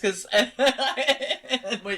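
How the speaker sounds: laughing while talking; giggly. Real, not acted. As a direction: still giggling, trying to carry on. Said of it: "I couldn't stop giggling".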